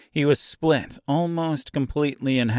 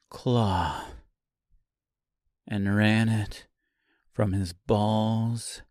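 slow, deliberate calm, softening at the end; chillingly calm confession with long pauses; 4 dB quieter, slower than usual. A narrator's reading. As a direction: lay it out calmly, phrase by phrase. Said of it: "she said calmly".

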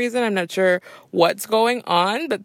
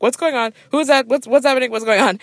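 higher, louder excited gasps; excited disbelief; 6 semitones higher, 5 dB louder, faster than usual. Retold or imitated. Fan spotting her favourite singers on TV, excited. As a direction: freak out happily. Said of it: "I was like".

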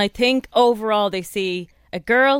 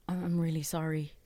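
poor me; 4 semitones lower, 12 dB quieter than usual. Retold or imitated. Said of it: "that kind of poor me".

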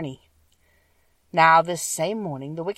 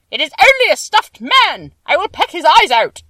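much higher, loud crow voice; scornful King Crow; 11 semitones higher, 13 dB louder than usual. A narrator's reading. King Crow scoffing at the Scarecrow, much higher and loud. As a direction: caw it out with scorn.

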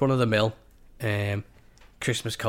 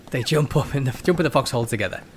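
normal talking voice

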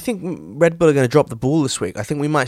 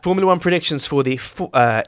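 usual speaking voice

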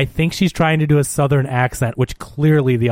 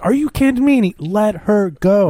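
retold incredulous protest; 7 semitones higher than usual. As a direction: act out outraged disbelief, stretching the last words. Retold disbelief at order to release her.

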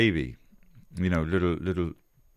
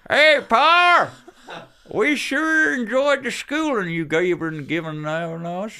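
high, loud hammed-up country kids; Jack and Dan impression; 12 semitones higher, 7 dB louder, slower than usual. Two boys hollering to their Pa about schooling, hammed-up high voice. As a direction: holler to Pa in a put-on kid voice. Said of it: "Jack and Dan be like this".

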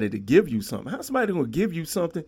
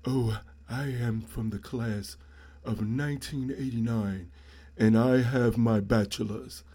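mock stuck-up graduate; 5 semitones lower, slower than usual. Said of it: "you ain't gotta be like".

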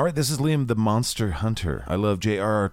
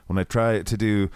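normal talking voice

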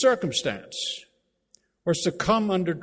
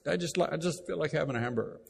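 quick casual request; 4 dB quieter, much faster than usual. Retold or imitated. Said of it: "if I say".

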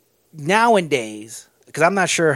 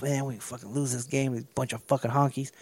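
much softer, low defeated grumble; mock dejection; 5 semitones lower, 11 dB quieter than usual. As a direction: sulk like a beaten player. Mock players dejected at halftime.

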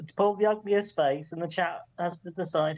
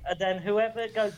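ordinary delivery

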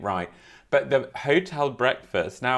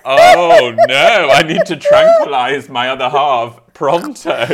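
loud, high mock-horrified outburst; hammed-up panic at a partner's embarrassing behaviour; horrified dismay; 5 semitones higher, 16 dB louder than usual. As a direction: wail it in comic alarm. Horrified reaction to partner's accent.

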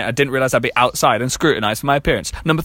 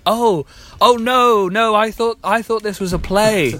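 high, slow, startled backpedal; mock panic; 7 semitones higher, slower than usual. Retelling his escape from the Dianetics stand, high and panicked. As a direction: play it as a flustered excuse, pitched up and drawn out. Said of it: "And I went".

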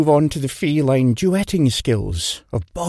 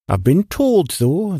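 ordinary delivery

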